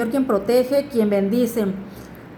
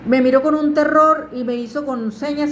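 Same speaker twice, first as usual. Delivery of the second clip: ordinary talk, nothing marked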